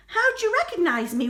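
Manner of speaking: a light wailing turkey; mock wail. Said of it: "wailed Turkey".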